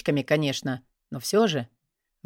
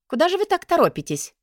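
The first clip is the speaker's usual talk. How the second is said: high mocking teacher voice; sarcastic teacher; 13 semitones higher, 4 dB louder than usual. A narrator's reading. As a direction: needle the student sharply. Teacher scolding student in class.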